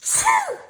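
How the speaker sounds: big high gasp before a sneeze; sharp sneeze gasp. Acted. Gerald gasping as a sneeze comes on.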